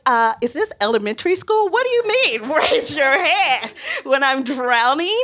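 high-pitched, disbelieving comeback; incredulous. Retold or imitated. Storyteller questioning the boat worker's absurd safety advice, incredulous. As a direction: retort in rising, mock-outraged disbelief.

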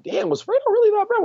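very high, quick wondering voice; put-on falsetto musing; mock second thoughts. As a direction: imitate someone rethinking in a squeaky rush. Voicing listeners reconsidering the album.

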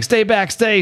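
high-pitched acted warning; alarmed plea. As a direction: imitate someone fending off an attacker. Acts Hulk warding off his father.

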